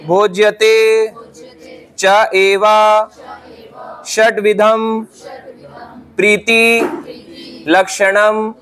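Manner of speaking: slow, word-by-word recitation; slow motion. Shown as a demonstration. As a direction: recite each word slowly for repeating. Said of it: "slow motion में बोलूंगा।".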